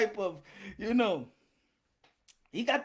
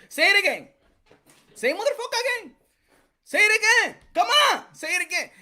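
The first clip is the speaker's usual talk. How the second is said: taunting dare; 9 semitones higher, 6 dB louder, faster than usual. Acted. Daring Plant to repeat insult.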